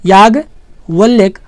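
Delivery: Yajnavalkya spoken very slowly, syllable by syllable; slow careful demo. Shown as a demonstration. Teacher slowly modelling the correct word for students, slow and careful. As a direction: spell Yajnavalkya out slowly for the class. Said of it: "ये एकदम सही शब्द होता है याज्ञवल्क्य".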